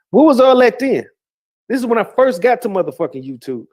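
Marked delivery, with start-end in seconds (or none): 0.1-1.1 loud, higher indignant challenge; heated where was that then; angry demand. Real, not acted. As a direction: throw the question at her hard.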